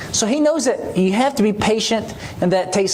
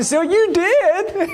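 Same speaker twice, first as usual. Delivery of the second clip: tearful surprise; 12 semitones higher, much slower than usual. Retold or imitated. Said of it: "And so she's crying".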